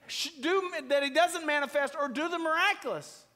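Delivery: high, loud, driving sermon; full-force preaching. Real, not acted. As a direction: preach it hard to the congregation.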